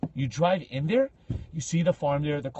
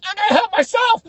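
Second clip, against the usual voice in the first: high, loud frantic shout; mock panicked refusal; 10 dB louder than usual. Visitor to girl begging for help, mock panicked shout. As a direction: yell it while bailing out.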